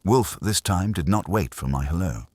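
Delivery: plain reading voice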